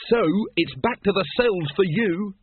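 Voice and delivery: high-pitched villain's gloat; gloating threat. A narrator's reading. Once sends the patrician back to the cells, gloating.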